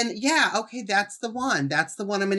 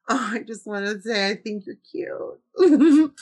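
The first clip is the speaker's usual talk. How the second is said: mock flirty swoon; 3 semitones higher than usual. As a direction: coo it like a smitten fan. Mocking a flirt, gasping.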